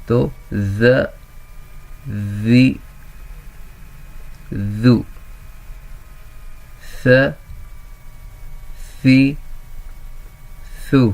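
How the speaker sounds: ذ ذی ذو then ث ثی ثو, one at a time; teacher chants the syllables in order; recital drill. Shown as a demonstration. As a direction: recite each syllable with long pauses.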